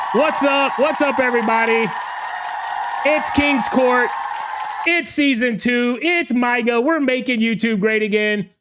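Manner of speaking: shouting welcome to the live chat; hyped show opener. Real, not acted. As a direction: hype up the crowd at the top of the show. Host re-hypes show opening.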